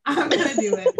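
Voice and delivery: laughing through the words. Real, not acted. Declaring it to her cohost, laughing. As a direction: giggle it out.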